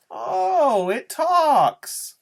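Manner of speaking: high, loud squeal of surprise; mock amazement. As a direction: squeak it out like he can't believe it. Freak staunt über sprechenden Jungen.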